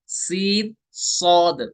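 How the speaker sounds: सीन thin, सौद full and heavy; सीन light, सौद heavy; thin then full. Shown as a demonstration. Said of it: "सीन को बारीक पढ़ना है".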